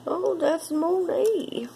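high, slow, mock-grand "Oh, that's Monet"; hammy fancy voice. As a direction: put on airs like a snob. Fancy mock-posh voice, drawn out.

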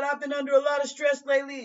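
lightly voiced anxious mother; pleading mother. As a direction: plead stress as an excuse. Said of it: "She said".

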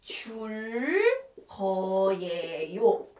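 "줄 거예요" slowly, every sound stretched; teacher sounding out "줄 거예요" piece by piece; drawn-out demonstration. Shown as a demonstration. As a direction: stretch out "줄", pause, then a long low "거예요". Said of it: "So we have to put".

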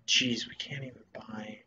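whispered under breath at the prices. Real, not acted. Grumbling to himself at shop prices, whispered. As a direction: breathe it out unvoiced.

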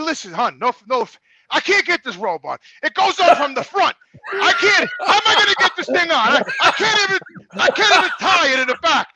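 loud, high, laughing protest building to a shout; half-shouting through laughter, helpless with the robe; laughing exasperated. Retold or imitated. Telling the X-ray technician he can't tie the robe, laughing loud protest. Retelling his panic over the robe.

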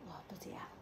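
whisper. Real, not acted. Quiet house, baby asleep.

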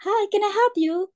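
high, bright shop-assistant greeting; cheerful shop assistant; imitating shop staff. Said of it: "it's just like".

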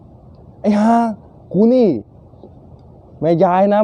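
playing a scandalised mother-in-law; indignant mother-in-law. Mother-in-law's indignant reply, acted.

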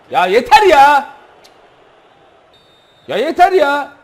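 high, loud, slow yelling in rage; furious shout. Real, not acted. Gamer raging at the referee's foul calls, furious high shout. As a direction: explode at the screen.

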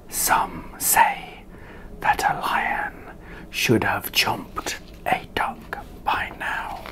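hushed whispered aside. A narrator's reading. Narrator whispers to the listener, hushed. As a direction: whisper it like a secret.

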